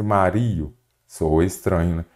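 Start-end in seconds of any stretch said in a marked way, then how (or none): 0.0-0.7 Mario mispronounced as ma-RI-o; stress on the i. Shown as a demonstration. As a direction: say Mario wrongly, stretching a stressed RI. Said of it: "Nada de Mario". (Mario com o i tônico, ma-RI-o)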